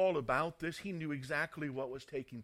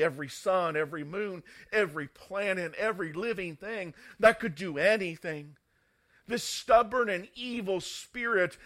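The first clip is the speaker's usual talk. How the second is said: loud, driving sermon delivery; emphatic preacher, rising in intensity; full-force preaching; 4 semitones higher, 11 dB louder than usual. Real, not acted. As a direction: proclaim it forcefully, hammering each item of the list.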